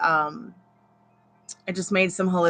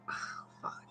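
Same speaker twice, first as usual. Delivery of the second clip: whispered curse of shock; 21 dB quieter than usual. Retold or imitated. Hearing her best friend had died, stunned whisper.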